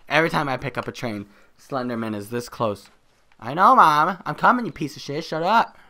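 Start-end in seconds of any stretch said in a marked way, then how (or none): 3.4-5.8 high, loud tantrum at Mom; mock bratty yelling. Son yelling back at Mom, mock angry outburst. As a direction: pretend to snap back loudly at your mother. Acted quarrel with his mom.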